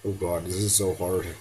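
normal talking voice

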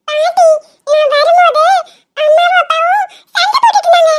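put-on high little girl's voice telling tales; child voice. Little girl tells grandma her parents were fighting, high child voice. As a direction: play the small daughter tattling.

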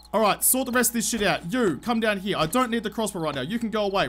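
normal talking voice

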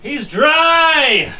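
high, excited reveal; celebratory high-pitched call; triumphant shout. Real, not acted. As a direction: cheer the good news out loud.

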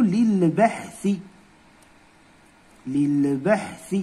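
للبحث said slowly, each sound clear; slow careful teacher demo of للبحث; slow model reading. Shown as a demonstration. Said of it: "إذا شنو غنقولوا؟".

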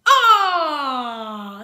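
a prince pining for his love; lovesick hero sigh. Shown as a demonstration. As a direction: swoon over her name, then let it all out. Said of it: "a big, loving, longing sigh at the end".

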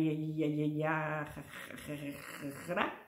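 quiet, quavering stammer, stuck on each sound; timid shivery stammer dropping very soft; trembling stutter. A narrator's reading. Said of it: "trilde en bib-, bibberde en zei".

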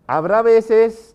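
loud, high and drawn out; calling out. Real, not acted. Teacher addressing the chicos about two cases, calling out. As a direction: project slowly to get the class listening. Teacher calls class to attention.

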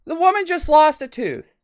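high-pitched mock nonchalance; sarcastic dismissal. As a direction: mimic her shrugging it off, indignantly. Sarcastically echoes driver's dismissal.

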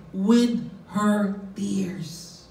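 "With her tears" very slow, every word stretched; emphatic. Shown as a demonstration. Preacher repeating the Greek emphasis, "With her tears" slow and deliberate. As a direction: lean on each word of "With her tears", drawing it out. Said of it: "In Greek, that was emphasis".

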